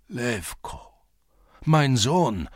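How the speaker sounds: high, drawn-out moan of disbelief; groaning in astonishment. A narrator's reading. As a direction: groan it out, aghast. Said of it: "stöhnte er". (fassungslos aufstöhnen)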